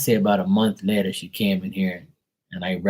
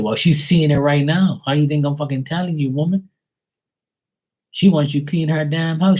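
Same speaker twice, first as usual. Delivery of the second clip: scolding rant; 6 semitones higher than usual. Retold or imitated. Medium scolding client for mother.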